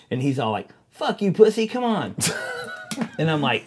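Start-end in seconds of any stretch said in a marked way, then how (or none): 0.9-2.2 higher-pitched impatient jeer; mock heckling. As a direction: jeer at a buddy to get on with it. Retold heckling tattoo client.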